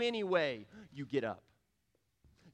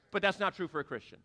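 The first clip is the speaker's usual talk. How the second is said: ordinary delivery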